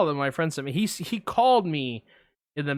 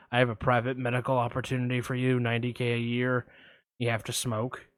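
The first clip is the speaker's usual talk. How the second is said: lurk low like a shady caller; creepy caller; 4 semitones lower, 5 dB quieter, faster than usual. Retold or imitated. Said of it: "I'm gonna play both parts".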